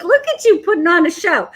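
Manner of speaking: amused imitation of someone making fun; playful teasing. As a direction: rib someone lightly in a brisk, lifted voice. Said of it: "He's making fun of me".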